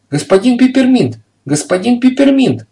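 high, loud yell of his name; shrieking call. A narrator's reading. Хозяйка зовёт Пепперминта с кухни, громко и высоко. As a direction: scream for him from the kitchen. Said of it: "завопила она".

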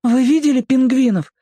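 put-on very high, childlike question; eager boy's voice. A narrator's reading. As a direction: voice a small boy asking eagerly. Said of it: "спросил мальчик". (высоким голосом мальчика)

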